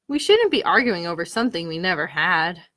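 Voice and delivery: mild regret, letting it go; sighing. Acted. Squirrel admitting the fight was silly, mild sigh. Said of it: "Squirrel sighed".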